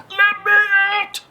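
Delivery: high, pleading cry from the bottle; trapped genie pleading. Acted. Genie sealed in the bottle begging the fisherman, high plea. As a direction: beg to be let out, high and urgent.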